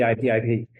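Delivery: ordinary talk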